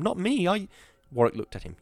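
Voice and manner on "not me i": high-pitched protest, cut off; urgent high exclamation, breaking off; exclaiming. A narrator's reading. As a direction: protest in a much higher voice. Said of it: "Wisconski exclaimed".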